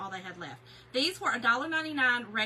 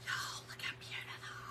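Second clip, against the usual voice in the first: breathy hush of wonder over pretty flowers; awed whisper; 10 dB quieter than usual. Real, not acted. Showing viewers the florals, awed whisper. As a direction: whisper it like you can't believe how pretty.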